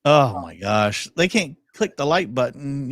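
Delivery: ordinary talk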